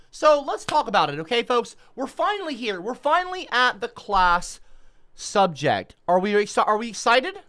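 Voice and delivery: high, loud game-show enthusiasm; hype host voice. Acted. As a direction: pump up the class audience. Hyping the class topic like a host.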